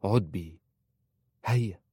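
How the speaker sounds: brief, firm command; understated resolve; decisive. A narrator's reading. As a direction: order it with finality. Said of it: "قالت في حسم".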